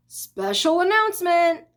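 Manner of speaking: high, stretched announcer voice; grand, self-important public announcement; pompous loudspeaker. A narrator's reading. Loudspeaker opening a special announcement at the fair, pompous. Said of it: "in a pompous voice".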